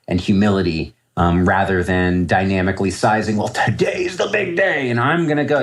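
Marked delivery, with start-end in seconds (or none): 3.4-5.6 cocky trader voice. Retold or imitated. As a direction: lightly ham up a trader sure today's his big day. Mocking an overconfident trader.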